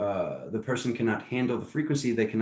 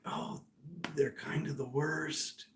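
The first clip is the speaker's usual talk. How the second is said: whisper; 4 semitones higher, 12 dB quieter, slower than usual. Retold or imitated. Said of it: "Spirit's like".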